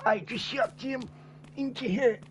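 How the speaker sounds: put-on a friend impersonated as an old Viking. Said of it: "He reminds me of an old Viking, you know, when he talks".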